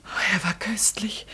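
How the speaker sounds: slow breathy whisper; slow rapt whisper. Acted. Dreamy answer about peach.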